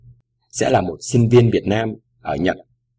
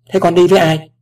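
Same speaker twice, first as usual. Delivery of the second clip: higher, louder stern question; 7 semitones higher, 5 dB louder than usual. A narrator's reading. Father checking who will come with his daughter, stern. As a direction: question firmly, like a protective parent. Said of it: "bằng một giọng nghiêm nghị".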